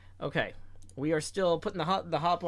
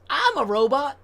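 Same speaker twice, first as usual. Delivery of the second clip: robot; 10 semitones higher, 9 dB louder than usual. Retold or imitated. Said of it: "Quirky says".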